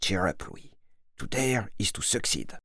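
soft, low-key murmur to oneself; hushed private pep talk; inner repetition. A narrator's reading. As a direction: repeat it quietly under your breath. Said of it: "se répète intérieurement".

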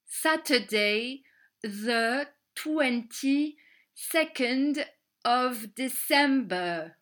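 very slow, word by word; slow repeat for learners. Shown as a demonstration. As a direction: spell it out slowly for the class. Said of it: "Samedi vingt-deux décembre". (lentement, mot par mot)

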